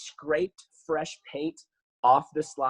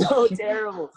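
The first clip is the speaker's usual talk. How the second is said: high, loud burst through laughter; laughing; 7 semitones higher, 5 dB louder than usual. Real, not acted. Guest cracking up over an infamous video, laughing. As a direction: crack up while saying it.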